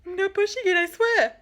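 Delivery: high, mock-innocent teen voice; mock girl protesting. Mocking the girl at the Ouija board, high and innocent. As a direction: play the girl swearing innocence.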